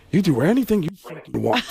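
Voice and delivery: the sneer is pushed home. Retold or imitated.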